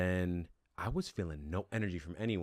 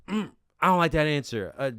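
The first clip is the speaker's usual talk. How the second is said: picky disapproval; 6 semitones higher, 5 dB louder than usual. Retold or imitated. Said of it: "And then she goes".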